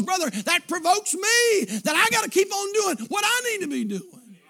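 high, loud, driving sermon cry; preaching at full force. Acted. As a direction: preach it hard to the pews.

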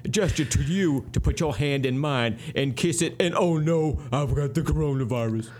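hammed-up blustery Southern rooster; Foghorn Leghorn. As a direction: boom it like a cartoon Southern rooster. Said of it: "It's Foghorn Leghorn".

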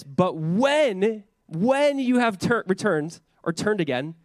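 preacher pressing the word when, high and drawn out; stress on WHEN. Shown as a demonstration. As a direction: lean hard on "when". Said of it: "But when, not if".

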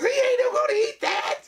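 high, slow shocked yell; lady in audience. Retold or imitated. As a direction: shriek it like the lady in back. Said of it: "she goes".

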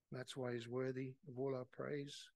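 usual speaking voice

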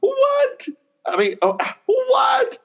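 high shrill repeated what; shocked disbelief. Retold or imitated. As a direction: jaw on the floor, voice climbing. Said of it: "being so shocked".

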